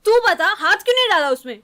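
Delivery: pointed comeback, hard on the opening words; indignant retort. Acted. Speaker challenging the listener about the hand, indignant retort. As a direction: throw the question back sharply. She throws the question back.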